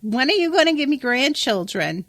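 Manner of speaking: lightly mock the pushy mum; mock nagging parent; overbearing mother. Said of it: "an overbearing mother".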